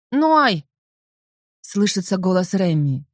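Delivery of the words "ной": loud call from downstairs. A narrator's reading. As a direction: shout the name up the stairs. Father calling from afar.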